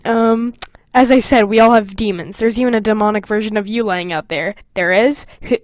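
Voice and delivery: ordinary delivery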